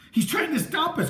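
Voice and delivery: high panicked cry; high-pitched terrified cry; frightened cry. A narrator's reading. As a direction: cry out in fear. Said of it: "Chris cried".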